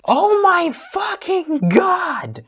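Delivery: high, slow stretched-out cry; exasperated outburst. Real, not acted. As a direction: drag it out in total exasperation.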